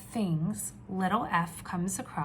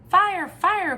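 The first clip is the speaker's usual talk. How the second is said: high, loud urgent cry; little F calling fire; 10 semitones higher, 11 dB louder than usual. Acted. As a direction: yell it in alarm. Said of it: "cried little F".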